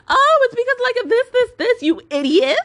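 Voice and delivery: high-pitched mocking imitation; snooty. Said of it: "gave it to me in a really, like, snooty way".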